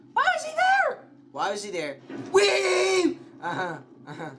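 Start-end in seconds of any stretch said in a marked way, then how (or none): 0.1-1.0 very high, loud shriek of alarm; panicked scream. Real, not acted. Player screams at a figure appearing, panicked. Jump scare in game. 2.3-3.2 loud, high-pitched squeal; high scream. Real, not acted. Player shrieks at the game, high scream.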